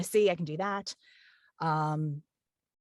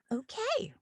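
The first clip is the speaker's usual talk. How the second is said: much higher, bright and obliging; mock-cheerful agreement; 7 semitones higher than usual. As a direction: play the eager-to-please yes-man. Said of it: "I'm like".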